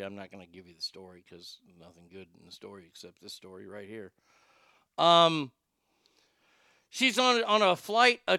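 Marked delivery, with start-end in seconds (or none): 0.0-4.1 very low and soft, quick; hushed aside. Real, not acted. Host confides before sharing the worst story, hushed aside. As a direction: drop right down to a private murmur.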